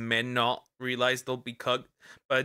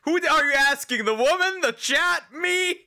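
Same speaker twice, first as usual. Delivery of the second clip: loud, very high yelled questions; incredulous shout; 5 dB louder than usual. Real, not acted. Streamer demanding who is being asked, incredulous shout. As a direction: yell each question in total disbelief.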